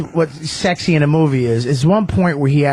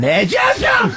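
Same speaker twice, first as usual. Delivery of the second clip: high-pitched mimicked outburst, garbled shout; sudden rage; slower than usual. Imitating the actor's movie rage to his face, sudden high shout. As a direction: explode into a mock rage out of nowhere. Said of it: "His rage".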